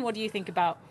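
ordinary delivery

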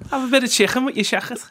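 high, fast Scouse caricature; Liverpool accent. Retold or imitated. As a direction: rattle it off in broad Scouse. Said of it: "sounds brilliant in a Liverpool accent".

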